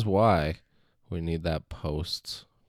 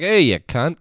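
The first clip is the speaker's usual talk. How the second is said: mock Australian-British accent; 10 semitones higher, 12 dB louder than usual. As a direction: friendly call in a light Australian or British accent, high and loud. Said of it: "same emphasis when they say it".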